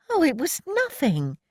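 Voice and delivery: high, shy modest deflection; flustered. A narrator's reading. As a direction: brush it off, embarrassed. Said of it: "she flushed".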